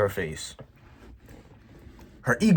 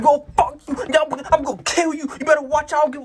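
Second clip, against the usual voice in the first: much higher, louder mock yelling man; imitating a crackhead yelling; 5 dB louder than usual. Vlogger imitating the man screaming threats at his car, high mock yelling. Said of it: "he was yelling at me".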